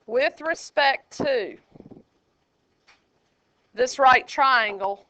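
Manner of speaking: "With respect to this right triangle" drawn out slowly, each word weighted; teacher spelling out the key phrase slowly, emphatic; slow, stressed phrase. Shown as a demonstration. Teacher to class, stressing the key phrase slowly. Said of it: "here's the part you gotta know how to do".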